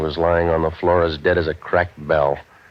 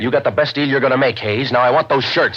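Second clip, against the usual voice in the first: higher and louder, no give; hard demand; 7 semitones higher, 5 dB louder than usual. Acted. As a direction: stand your ground and demand it. Novak faces down Hayes.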